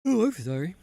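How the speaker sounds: words stretched by a yawn. Real, not acted. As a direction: talk through a big yawn. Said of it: "Yawning here".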